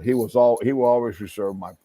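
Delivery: usual speaking voice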